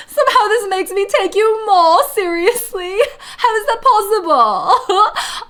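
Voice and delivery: put-on high, loud British Valley Girl with stretched endings; hammed-up posh Brit Valley Girl, laughing. Shown as a demonstration. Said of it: "Imagine if this became my forever accent".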